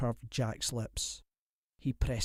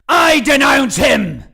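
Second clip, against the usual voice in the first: slow, much louder bellow; furious shout; 14 semitones higher, 16 dB louder than usual. A narrator's reading. Lord bellowing in his enemy's face.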